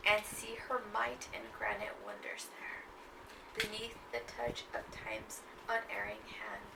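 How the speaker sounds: slow, hushed recital fading out; poem reading. Acted. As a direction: read it darkly and slowly, trailing off. Reads final stanza.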